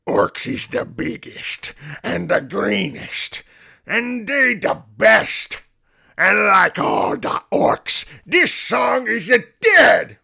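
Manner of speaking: hammed-up orc war chant rising to a roar. Shown as a demonstration. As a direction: growl it out like a war-mad orc. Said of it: "I'm gonna talk like an orc".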